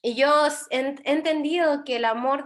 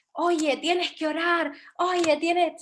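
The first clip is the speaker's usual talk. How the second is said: imitar una leve insistencia exigente, alargando las palabras; exigencia imitada; 3 semitones higher, slower than usual. Acted. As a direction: presionar un poco, como quien exige de mentira. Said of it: "si te están exigiendo". (tono de exigencia fingida)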